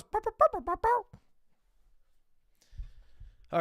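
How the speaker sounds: high-pitched mocking "bow-bow" noises; mocking imitated noises. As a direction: rattle off high, silly "bow" sounds. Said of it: "All they go is".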